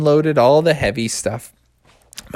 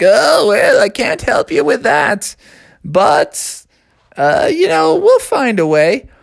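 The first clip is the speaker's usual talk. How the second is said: put-on wise old owl; 10 semitones higher, slower than usual.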